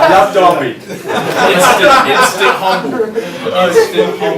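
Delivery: laughing loudly through the words, pitch up high; giddy laughing joy, the last "instant humble" slowed right down. Real, not acted. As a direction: gush it out between real laughs. Laughing delight at the Derby crowd.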